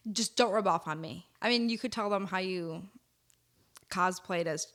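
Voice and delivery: ordinary talk, nothing marked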